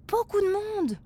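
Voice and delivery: put-on high, loud, excited child. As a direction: call out in wonder. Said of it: "s'exclame Flora".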